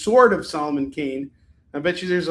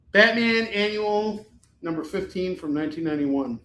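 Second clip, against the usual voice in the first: ordinary talk, nothing marked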